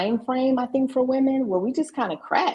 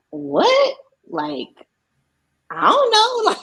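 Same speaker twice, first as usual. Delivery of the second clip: loud, high-pitched disbelief; baffled outburst; 6 dB louder, much slower than usual. Retold or imitated. Daughter thrown by mom's question, baffled and loud. Retelling her startled reaction to mom.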